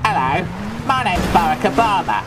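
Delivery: hopeless impression of a leader, in your own accent; Barack Obama impression. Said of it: "I'll do one of Barack Obama".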